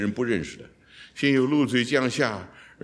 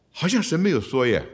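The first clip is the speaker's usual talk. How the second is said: ordinary talk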